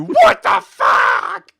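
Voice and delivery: high, loud, slow outraged yell; shocked shout. Retold or imitated. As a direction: let the real reaction burst out. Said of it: "Which equates to".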